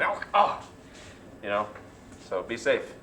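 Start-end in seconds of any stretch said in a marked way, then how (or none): 0.0-0.7 higher, hammed-up ouch; mock pain cry. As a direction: fake a little hurt cry. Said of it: "instead of saying".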